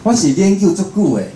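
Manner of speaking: ordinary delivery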